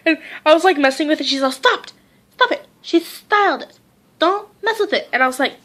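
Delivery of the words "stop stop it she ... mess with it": grandma scolding. Retold or imitated. Grandma scolding about the hair.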